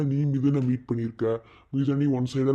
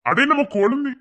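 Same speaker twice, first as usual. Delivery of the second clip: much higher, louder burst of delight; excited exclaim; 13 semitones higher, 7 dB louder than usual. Acted. Relative spotting the family's child, high and loud. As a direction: gush over the little one.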